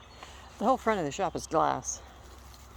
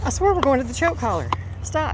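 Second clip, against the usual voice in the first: exasperated raised voice at dog; scolding; 8 semitones higher, 6 dB louder than usual. Real, not acted.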